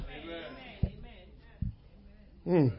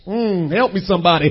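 ordinary delivery

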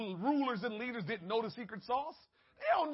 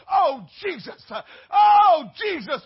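crying out Jesus; 4 semitones higher, 14 dB louder than usual. Retold or imitated. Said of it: "how often you hear people say".

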